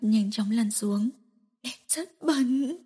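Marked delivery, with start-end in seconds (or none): 1.6-2.8 slow tearful murmur. Acted. Woman crying after speaking.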